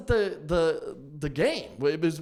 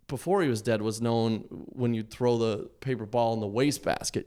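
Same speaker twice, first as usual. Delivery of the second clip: ordinary talk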